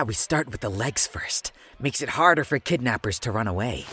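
soft, breathless threat; menacing whisper. Acted. Detective whispering a threat to the suspect. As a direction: whisper it to scare him.

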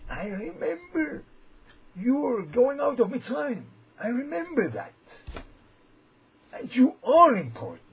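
high, slow, loud calling out; God screaming into the ear. Acted. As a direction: cry it into the ear, rising to a shout. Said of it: "he screams inside".

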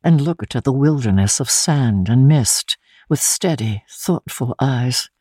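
ordinary delivery

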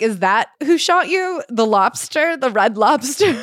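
mock incredulous. Host mocking the lawsuit, slow sarcastic disbelief. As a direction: ask it like it's absurd. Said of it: "And I'm like".

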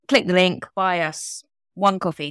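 ordinary talk, nothing marked

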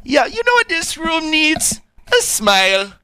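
very high mock Jamaican lilt; put-on, high-pitched Jamaican accent. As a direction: do the cheery Jamaican commercial guy. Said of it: "the Jamaican accent".

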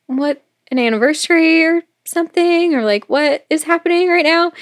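puzzled, lifted questioning voice; baffled retelling. Retold or imitated. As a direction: act out a bewildered boyfriend fishing for an explanation. Retold boyfriend baffled by surprise evening.

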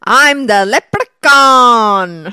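slow, drawn-out theatrical leprechaun cry; horror leprechaun voice. Pretend. Contestant trying out her buzzer line, hammy leprechaun. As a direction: stretch the last word into a big howl.

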